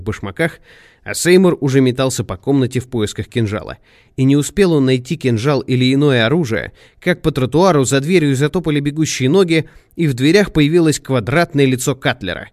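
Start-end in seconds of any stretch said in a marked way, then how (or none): none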